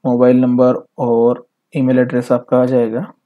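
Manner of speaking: ordinary delivery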